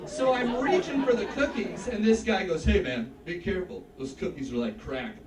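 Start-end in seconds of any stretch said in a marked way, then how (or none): 2.6-5.2 low-pitched cautioning guy, dropping lower; guy's low warning. Acted. Guy warns him about the cookies, low warning voice. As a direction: give a low, confidential heads-up.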